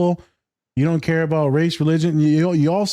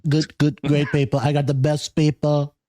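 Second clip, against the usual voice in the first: Trump impression. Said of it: "That somehow turned into, like, a Mexican band".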